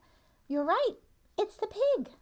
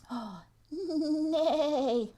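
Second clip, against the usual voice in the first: horse neigh; 7 semitones higher than usual. Pretend. Voicing horse with gasp.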